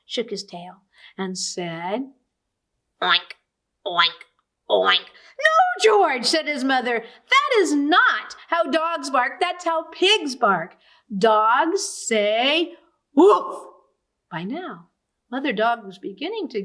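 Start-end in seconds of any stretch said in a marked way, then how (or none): 3.0-5.1 lower, punchy oink oink oink; puppy oinking instead of barking; pig oinks. A narrator's reading. 5.1-6.3 much higher, louder cry of no; mother dog alarmed by the oinks; shocked mother dog. A narrator's reading. 7.0-13.6 higher, slow explaining; mother dog teaching her pup; patient mother dog. A narrator's reading.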